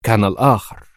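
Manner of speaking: lightly weighted revelation; pointed special tone. A narrator's reading. Leader leaning in to reveal the double, pointedly. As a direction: deliver it as a meaningful revelation. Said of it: "مضيفة في لهجة خاصة".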